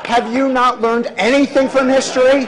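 loud, high comic rant; hammed-up scolding at full volume; mock exasperated scolding. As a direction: holler in mock disbelief over a laughing crowd.

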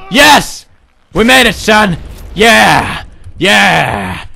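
loud, high triumphant shouts; cheering father. Acted. Father celebrating with his son, loud cheering. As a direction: whoop in victory at your son.

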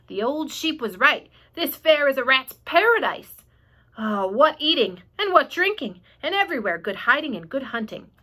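hammed-up greedy rat; gloating rat. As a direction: revel in the feast ahead.

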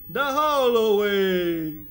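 slow, loud, drawn-out The hallway; slow, loud sing-song The hallway. Acted. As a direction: stretch the hallway like a cheer. Repeats word, then sings it.

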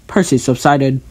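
usual speaking voice

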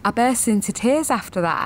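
ordinary talk, nothing marked

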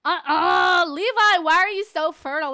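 loud, high exasperated scold; a player yelling at her sim Levi for getting pregnant, loud. Real, not acted. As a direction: scold Levi at full volume.

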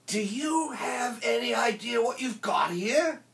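high-pitched amazed character voice; acted astonishment in a put-on voice; incredulous Teddy Boy. A narrator's reading. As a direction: ask in disbelief, pitch held high. Teddy Boy amazed at rare records.

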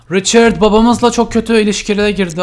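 gasp, then a loud shocked outburst. Real, not acted. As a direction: blurt it out in shock. Shocked at game drama. (şaşkınlıkla nefesini tutup yüksek sesle)

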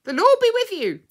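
very high, loud parrot squawk; piercing bird voice on one held high pitch; parrot mimicry. A narrator's reading. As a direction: screech it out like a parrot reciting. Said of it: "The parrot was very clear and had learnt to mimic well".

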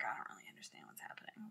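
put-on captain whispering like a confused child to the officer; lost little boy whisper. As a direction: sound small and bewildered. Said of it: "like a little boy".

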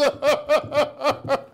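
high manic cackle; mad laugh. Acted. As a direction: cackle madly.

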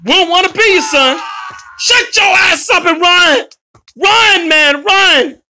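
high, loud taunts on the run; loud, high-pitched urgent shouting; excited yelling. Real, not acted. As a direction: yell it as you make a getaway. Yelling while fleeing the killer in game.

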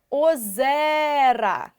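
ОЗЕРА with a long, drawn-out stressed Е; наголос на Е. Shown as a demonstration. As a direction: stretch the middle vowel of ОЗЕРА, very slow. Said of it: "наголос перейшов на цю букву". (ОЗЕРА з протяжним наголошеним Е)

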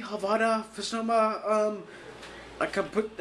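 normal talking voice

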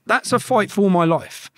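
ordinary talk, nothing marked

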